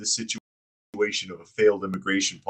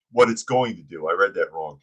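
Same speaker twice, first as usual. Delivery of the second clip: ordinary talk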